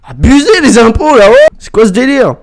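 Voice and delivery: outraged. Real, not acted. Outraged at game taxes.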